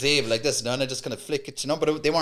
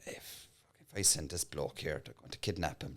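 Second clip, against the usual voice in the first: quiet aside; 9 semitones lower, 15 dB quieter than usual. Retold or imitated. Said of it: "I was like".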